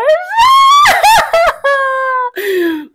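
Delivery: long, loud, high squealing laugh. Real, not acted. Vlogger showing her watch collection, squealing laugh. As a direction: burst into a high shrieking laugh.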